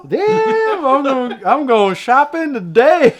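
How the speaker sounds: very high, loud shopper's glee; hammed-up excitement. Player going shopping at the fashion stall, gleefully hammed up. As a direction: whoop it high, stretching the words out.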